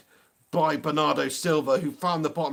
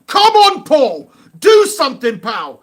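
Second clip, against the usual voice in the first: loud, high cry urging a player on; urging shout; 7 semitones higher, 11 dB louder, slower than usual. Real, not acted. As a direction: yell at the player to do something.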